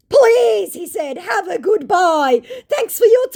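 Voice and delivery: high, loud, slow hammed-up farewell; mock scammer shout. Vlogger mocking the scammer's indignant goodbye with a yell. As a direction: ham up an outraged goodbye.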